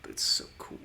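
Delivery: whispered aside, barely voiced. Real, not acted. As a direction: breathe it out as a secret.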